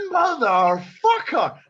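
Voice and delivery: slow, loud, high curse; explosive anger. Retold or imitated. Just punched in the nose, explosive drawn-out anger. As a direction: draw the curse out as the temper blows. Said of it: "I fucking explode".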